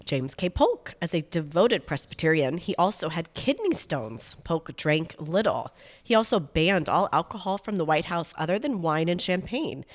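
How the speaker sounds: ordinary delivery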